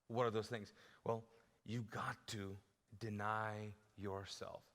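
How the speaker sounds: low, soft, earnest sermon voice; quiet intimate preaching. Acted. Pastor speaking softly of the cross, intimate preaching. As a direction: draw them in close and low.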